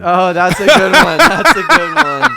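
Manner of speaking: high, loud laughing reaction. Real, not acted. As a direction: laugh through the words, delighted. Friend loves the worse option.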